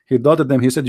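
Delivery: normal talking voice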